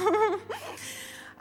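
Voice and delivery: a short, high, embarrassed laugh. Real, not acted. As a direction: laugh it off after getting emotional. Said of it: "sorry, I get all emotional".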